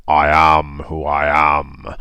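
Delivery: slow, booming divine pronouncement; big, deep, stately voice; big deep God voice. Acted. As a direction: play God, deep and drawn out. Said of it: "say it in that big, deep voice".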